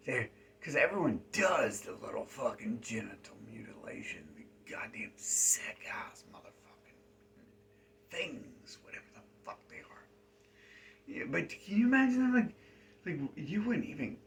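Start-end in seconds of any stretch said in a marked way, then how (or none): 0.6-10.0 secretive hushed whisper, as if someone might overhear. Real, not acted. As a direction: drop to a conspiratorial whisper. Conspiratorial aside about forbidden topic.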